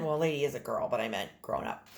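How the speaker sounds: usual speaking voice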